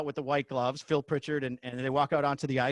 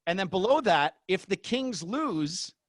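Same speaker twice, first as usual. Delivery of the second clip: slow, higher script read-out; 4 semitones higher, much slower than usual. Retold or imitated. Announcer reading both script versions, slow. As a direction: read each line like a cue card. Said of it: "two versions of the script".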